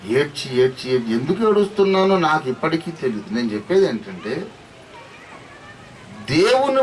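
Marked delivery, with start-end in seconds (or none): none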